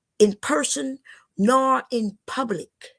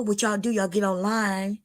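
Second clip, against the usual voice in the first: normal talking voice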